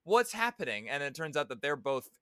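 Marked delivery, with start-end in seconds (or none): 0.0-0.9 baffled question. Retold or imitated. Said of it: "And it's like".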